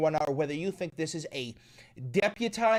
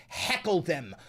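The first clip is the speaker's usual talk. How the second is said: ordinary talk, nothing marked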